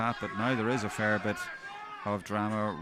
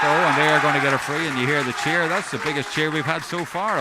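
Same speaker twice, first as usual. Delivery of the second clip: excited; 5 semitones higher, 10 dB louder than usual. Real, not acted. Free won, crowd cheering.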